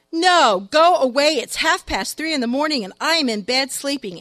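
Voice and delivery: loud, high irritated refusal; annoyed. Acted. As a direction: snap at the stranger to leave. Woken husband refuses.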